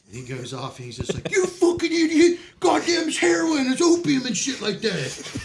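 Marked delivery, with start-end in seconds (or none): 1.3-5.4 high, loud laughing yell; a staff sergeant yelling at a soldier, retold through laughter; mock yelling sergeant. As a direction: bellow at him like an exasperated sergeant. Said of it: "my staff sergeant comes up and he's like".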